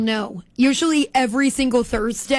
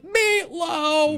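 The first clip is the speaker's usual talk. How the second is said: higher, drawn-out mock wail; hammed-up anguished call; retold cry of grief; 5 semitones higher than usual. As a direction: imitate kids crying out in dismay. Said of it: "all the radio kids are like".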